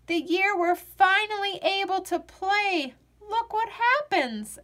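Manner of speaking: high, frustrated complaint; exasperated outburst. A narrator's reading. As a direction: cry out at the unfair luck. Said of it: "Alex said, slapping his sides".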